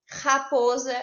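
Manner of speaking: slow "raposa" with a hard guttural first R; strong R. Shown as a demonstration. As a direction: lean on the throaty R that opens "raposa". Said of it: "R com som forte".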